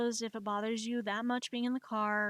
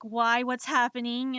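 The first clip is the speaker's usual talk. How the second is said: loud alarmed cry, voice rising; panicked yelp; 2 semitones higher, 8 dB louder than usual. Retold or imitated. Passenger blindfolded in a tipping car, loud alarmed cry. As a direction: shout it in sudden fright as the car tips.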